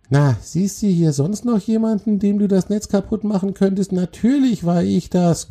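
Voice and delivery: very high, cross spider voice; grumbling. A narrator's reading. Spider answers the troll who broke her web, grumbling. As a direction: grumble indignantly in a tiny high voice. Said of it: "grummelte ihn die Spinne an".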